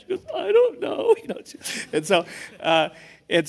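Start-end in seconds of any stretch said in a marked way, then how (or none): 0.3-1.1 very high, loud mock girlish voice; imitated daughter. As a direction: squeak it out as the clueless daughter. Voices daughter's bashful answer.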